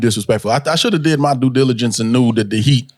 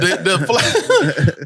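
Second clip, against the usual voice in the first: ordinary delivery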